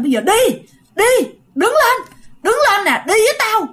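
a possessed spirit snaps at the family to move; furious command. Retold or imitated. Furious possessed spirit ordering the family to follow. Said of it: "đùng đùng đùng nổi giận".

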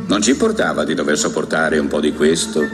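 normal talking voice

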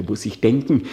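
ordinary delivery

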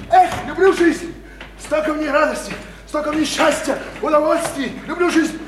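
high, gushing declaration of loving life; exuberant drunken joy. Acted. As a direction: revel in every word.

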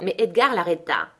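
plain reading voice

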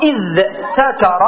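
"إذ تكر" as idh-takara, with clear t; earlier form. Shown as a demonstration. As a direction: keep dh and t apart in "إذ تكر". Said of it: "أصلها ذكر".

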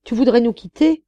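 high, loud pleading question; family pleads in despair. Acted. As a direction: beg her not to go. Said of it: "s'écrièrent-ils avec désespoir".

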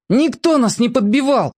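high protesting cry; boy's shout. A narrator's reading. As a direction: shout the denial. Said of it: "крикнул Мак".

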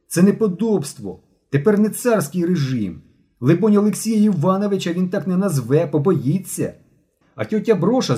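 high, emphatic public denunciation; indignant accusation. A narrator's reading. Boy denounces classmate before the class, indignant. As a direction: declaim it with rising outrage.

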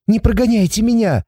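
high-pitched imploring cry; desperate plea. A narrator's reading. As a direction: beg not to be abandoned. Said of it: "закричала она с таким отчаянием".

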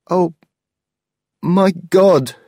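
a nephew hearing his life's work is gone; shocked exclamation. Acted. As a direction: gasp it out in disbelief. Ole's shocked outburst.

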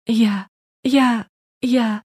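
high, broken sobbing stutter; sobbing stammer. Acted. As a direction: choke out each word through sobs. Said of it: "рыдала Наташа".

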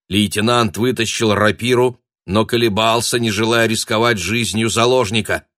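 ordinary delivery, nothing marked

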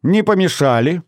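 high, merry question; cheerful. A narrator's reading. Mother walking in on the party, cheerful. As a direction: ask gaily, voice lifted. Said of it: "весело сказала она".

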